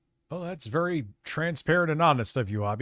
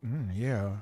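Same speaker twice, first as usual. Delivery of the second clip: sassy voice; 5 semitones lower, 5 dB quieter than usual. Pretend. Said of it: "I could just go".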